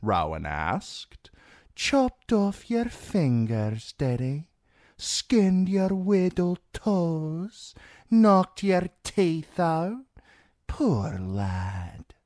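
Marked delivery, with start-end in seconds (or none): 1.7-10.1 very high, slow, drawn-out questions; mock-pitying washerwoman. A narrator's reading. Rowan asks Theon about torture, mock pity. 10.7-12.1 hushed, breath-voiced pity. A narrator's reading. Rowan pats Theon's cheek, hushed pity.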